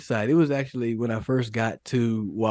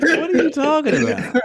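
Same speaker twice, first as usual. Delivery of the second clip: much higher, laughing incredulous question; laughing disbelief; 6 dB louder than usual. Retold or imitated. Retelling the teammates' reaction, laughing hard. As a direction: squeal it through laughter. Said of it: "You're like".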